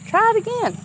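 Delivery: high, loud push on do it again; emphatic urging. Real, not acted. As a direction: urge them on, voice leaping up. Urging viewer.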